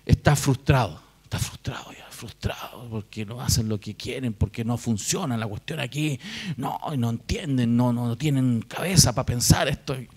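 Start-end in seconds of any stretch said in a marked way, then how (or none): 1.2-9.9 soft, half-whispered grumbling under the breath; frustrated muttering. Pretend. Pastor acting out a person who is angry inside, muttering. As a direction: mutter like someone stewing in silent anger.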